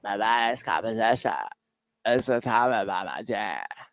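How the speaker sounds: mock the actress's sultry ad read for the radio audience; mocking her voice.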